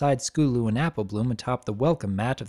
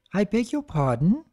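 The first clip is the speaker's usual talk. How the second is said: refined lady voice; 7 semitones higher than usual. Acted. Rarity leaning in.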